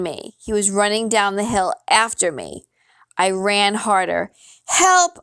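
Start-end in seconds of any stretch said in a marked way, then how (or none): 4.7-5.2 high, loud cry for help; scream for help. A narrator's reading. Boy chased downhill by a bear, screaming for help. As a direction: call out loudly, pitch jumping up. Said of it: "I screamed at the top of my lungs".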